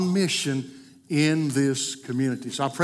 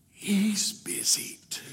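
put-on slow, secretive little boy whisper; child's whisper; 4 semitones higher, 6 dB quieter, slower than usual. Little boy whispering to the salesman on the phone. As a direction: breathe it like a kid hiding something. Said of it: "And the little voice said".

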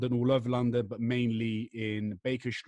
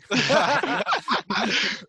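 a high cackle of glee; loud high laugh; 13 semitones higher, 7 dB louder than usual. Real, not acted. Laughs at own joke.

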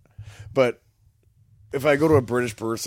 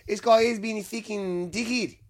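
higher, hammed-up Australian accent; mock Australian accent grumbling about a dickhead; bad Australian accent; 11 semitones higher than usual. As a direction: lay on a cheesy Australian accent. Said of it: "my shitty Australian impressions".

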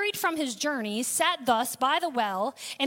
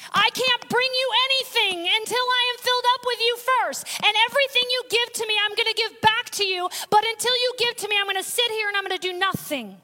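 fervent plea; 6 semitones higher, 3 dB louder than usual. Retold or imitated. Preacher voicing a believer to God.

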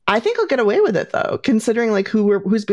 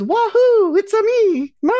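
Mario; 10 semitones higher, slower than usual. Retold or imitated. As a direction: whoop it out high and cheery. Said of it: "He goes".

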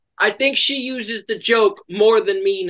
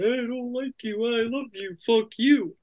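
mock Angel quote; 4 semitones higher, 5 dB quieter than usual. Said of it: "waiting for Angel to be like".

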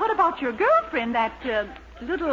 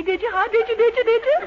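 eager pressing; 8 semitones higher than usual. Acted. Miss Baker begging Dennis to say he meant it, eager rising. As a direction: pester him, climbing with each ask.

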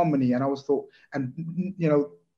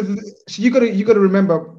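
louder, higher insistence; raised voice to cut in; emphatic cut-in; 7 semitones higher, 8 dB louder than usual. Real, not acted. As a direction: push in to grab the floor. Cutting in to make a point.

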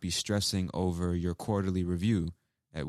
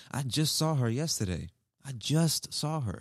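1 emphatic quote; 7 semitones higher than usual. Shown as a demonstration. Teacher acting out an emphatic quote.

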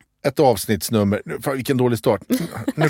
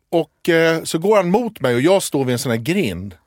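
usual speaking voice

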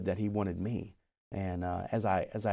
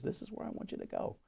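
whispered voice of God; 11 semitones higher, 12 dB quieter than usual. Retold or imitated.

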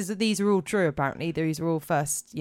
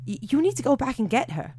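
higher, quick, slightly urgent demand; pitched up, hurried, a touch nervous; suppressed fear; 7 semitones higher, faster than usual. A narrator's reading. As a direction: hold down rising fear as you insist. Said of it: "trying not to show my rising fear".

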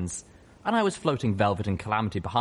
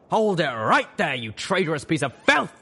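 bellowing; 9 semitones higher, 5 dB louder than usual. Acted. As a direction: bellow it at him. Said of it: "the drill sergeant bellowed".

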